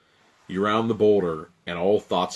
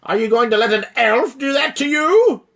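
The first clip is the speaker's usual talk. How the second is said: angry giant; 7 dB louder than usual. Acted. Frost giant scolding the wailing dragon. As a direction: bark at the dragon to get up.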